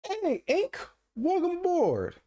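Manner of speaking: hammed-up falsetto welcome; mock greeting. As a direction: greet like a cartoon host.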